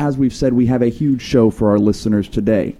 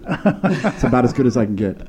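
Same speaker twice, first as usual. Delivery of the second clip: ordinary talk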